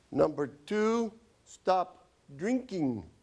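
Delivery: slow prescription. Acted. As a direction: lay out each word as an order to the patient. Writing the prescription out loud.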